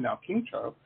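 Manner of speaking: ordinary talk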